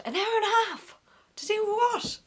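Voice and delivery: high, baffled disbelief; can't believe it would take that long; incredulous. Real, not acted. Echoing friends' claim, high and incredulous.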